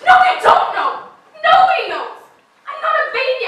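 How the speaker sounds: high, loud anguished yell; shout. Acted. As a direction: scream it at him. Shouting at her fiancé.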